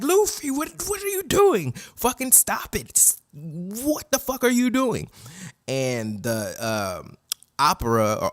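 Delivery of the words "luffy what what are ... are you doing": very high, frantic mock wail; distraught pleading. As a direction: plead in hammed-up despair with someone hurting himself. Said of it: "I'm crying my ass off".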